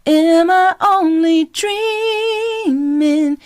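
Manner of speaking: ground it with a solid tone; solid sound. Shown as a demonstration. Coach showing the grounded solid way back down. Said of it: "a solid sound".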